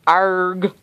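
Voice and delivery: growled pirate arg. Shown as a demonstration. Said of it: "I can go".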